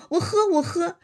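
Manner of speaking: a hint of panicked surrender; hurried plea. A narrator's reading. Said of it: "薛蟠忙说".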